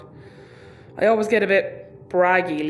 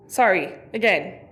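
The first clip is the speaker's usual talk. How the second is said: ordinary talk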